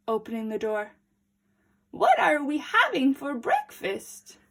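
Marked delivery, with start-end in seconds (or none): 1.9-4.4 granny voice. A narrator's reading. Granny asks.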